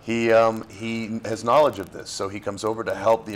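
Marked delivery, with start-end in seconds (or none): none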